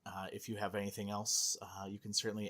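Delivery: host whispering an aside to co-hosts; whispered aside. Real, not acted.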